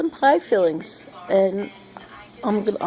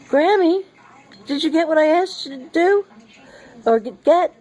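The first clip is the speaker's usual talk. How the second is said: put-on child voice; 7 semitones higher than usual. Granddaughter quoted asking grandma.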